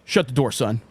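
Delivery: low, curt imitation of the boss; lightly voiced low authority figure; stern store manager. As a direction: drop the pitch and order him in. Manager orders scared bag boy.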